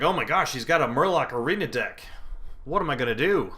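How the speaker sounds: loud, hammed-up dismay; mock panic. Gamer pretends his opponent's Murloc deck scares him, mock panic. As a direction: wail it in pretend alarm, voice swooping.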